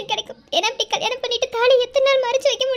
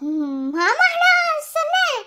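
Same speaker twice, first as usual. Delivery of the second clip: high, slow fake whimper; mock whimpering; 7 semitones higher, 4 dB louder, much slower than usual. Caught by her sister, she whines in a put-on cry. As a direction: drag it out like a caught child, whining.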